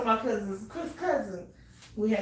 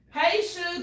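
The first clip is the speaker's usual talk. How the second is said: mock friendly greeting; 7 semitones higher than usual. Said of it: "I'm walking around saying".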